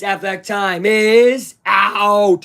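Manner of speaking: very slow, drawn-out sign-off phrase; signature sign-off. Shown as a demonstration. Host closing the video with his catchphrase, drawn out slowly. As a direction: stretch every word of the catchphrase. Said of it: "when I end the video next time on it like this".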